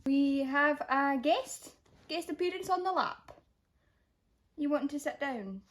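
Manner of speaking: talking up high and soft to a puppy on the lap. Real, not acted. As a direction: fuss over the dog gently.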